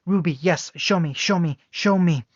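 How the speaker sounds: put-on weapon girl begging to see the sword, thrilled; eager begging. Weapon girl begging for the sword, giddy.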